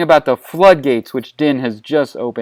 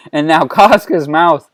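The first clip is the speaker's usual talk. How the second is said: plain reading voice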